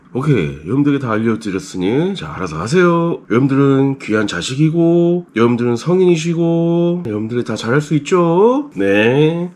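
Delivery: mock cool, nice teacher. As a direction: parody an accommodating teacher, stretching the line endings. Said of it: "되게 쿨하고 착한 강사 될 수 있어".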